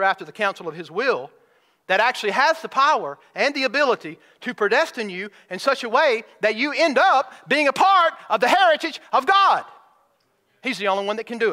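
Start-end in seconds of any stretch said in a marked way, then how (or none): 1.9-9.6 rising higher and louder until God rings out; building to a shout. Real, not acted. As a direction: climb to the peak on God. Preacher climaxes to God.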